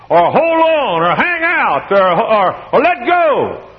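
mock exclaiming. Listing altar demands, mocking.

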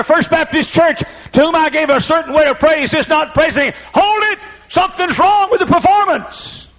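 high, loud commanding cry of hold it; a conductor stopping his orchestra, bellowing; God's commanding shout. Pretend. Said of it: "I wonder what God says this morning".

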